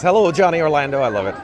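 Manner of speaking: ordinary talk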